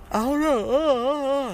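words stretched out by a big yawn. Real, not acted. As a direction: talk right through a yawn. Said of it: "Why am I yawning?".

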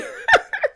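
high burst of amused laughter; real laugh. Real, not acted. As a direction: crack up after the mock complaint. Laughing at the acted complaint.

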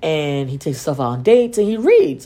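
usual speaking voice

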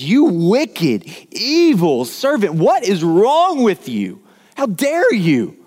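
a furious master berating his servant; angry king. Acted. Preacher voicing the king rebuking his servant: loud, high, slowing rebuke.

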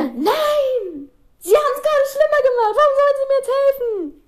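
high-pitched mock wail of protest; exasperated protest. Acted inner protest to teacher.

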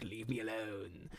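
low, soft, drawn-out mock moan; brooding, stretched-out plea; sullen Harry. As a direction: groan it slowly and quietly, hammed-up. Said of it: "And Harry's like".

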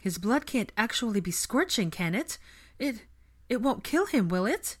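high, faltering worry; anxious questioning. A narrator's reading. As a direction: seek reassurance, fearing the worst. Frightened friend asking if he'll die.

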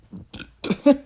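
gargalhada aguda de surpresa; rir-se alto de repente; riso agudo. Real, not acted. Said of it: "agora retei mesmo". (riso agudo e alto)

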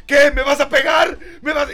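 defiant shout. Retold or imitated. Woman challenging him, shouted.

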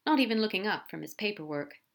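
usual speaking voice

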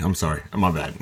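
ordinary talk, nothing marked

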